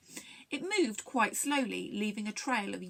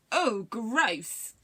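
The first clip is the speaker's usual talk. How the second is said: louder, gasping exclamation of disgust; gasped disgust; 6 dB louder than usual. A narrator's reading. Bird seeing a slimy room, gasped disgust. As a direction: gasp it out, mildly revolted. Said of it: "gasped Duffy".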